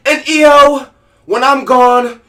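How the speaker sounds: high, loud mock-sweet voice, slowing at the end; hammed-up Io vowing devotion; Io impression. Mocking Io's promo to her partner, high and loud. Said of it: "that's my best E O impression".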